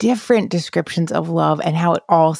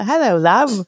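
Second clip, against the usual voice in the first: imitating corny British accent; 4 semitones higher than usual. Host echoing the guest's morning greeting, in a mock British accent. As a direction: toss back her cheesy British greeting.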